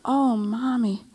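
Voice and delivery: gently indulge your mother, lightly imitated; a touch of tender daughter calling her mother; sweet adult-child voice. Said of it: "Katie said in this voice".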